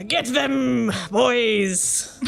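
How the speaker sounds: rally the crew like a pirate; pirate captain. Acted. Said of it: "and he says".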